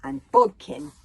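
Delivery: loud, high call of the name; ringing loud introduction; emphatic introduction. A narrator's reading. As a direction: announce the name with a flourish. Narrator introduces a character.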